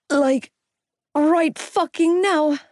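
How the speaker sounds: high, slow panicked insistence; pleading to get out now; panicky. A narrator's reading. Gibbsy panicking, nauseous.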